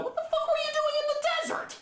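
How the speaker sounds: high-pitched comic outrage; exasperated disbelief. Acted. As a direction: rattle it off high, baffled and fed up. Exasperated heckling of the film's plot.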